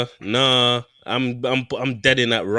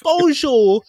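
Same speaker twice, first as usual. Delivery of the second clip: much higher, louder hammed French hello; mock French greeting; 10 semitones higher, 4 dB louder, slower than usual. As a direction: greet like a cheesy French tourist. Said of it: "it's all French anyway".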